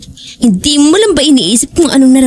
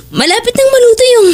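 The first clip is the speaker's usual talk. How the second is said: near tears; 11 semitones higher than usual. Acted. Wendy begging Jake to stay for dinner, near tears. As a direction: voice cracking high with tears. She pleads, he's leaving.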